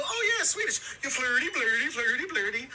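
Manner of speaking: high mock Swedish Chef gibberish. Tourist mocking Swedes with a high hammed-up chef voice. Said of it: "chef impression".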